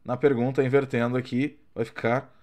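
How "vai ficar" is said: usual speaking voice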